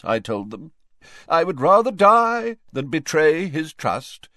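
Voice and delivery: pompous ghost in a high, declaiming voice; Nearly Headless Nick, self-important. A narrator's reading. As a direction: boast loftily, rising to a grand vow.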